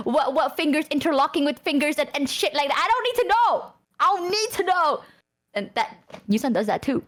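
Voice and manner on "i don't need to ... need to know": high, insistent protest; flustered protest. Acted. Refusing the friend's hand-holding details, high flustered protest. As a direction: raise the pitch and refuse, flustered.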